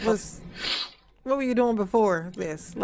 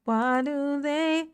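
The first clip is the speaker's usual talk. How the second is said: very slow, higher, stretched-out whine; whiny complaint; 3 semitones higher, slower than usual. Real, not acted. Complaining about sore shoulders.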